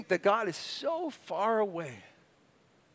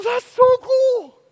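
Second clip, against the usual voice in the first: much higher, slow drawn-out wonder; amazed; 9 dB louder, much slower than usual. Retold or imitated. Looking at Jupiter's moons through telescope, amazed. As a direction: gasp it out in awe. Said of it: "I go".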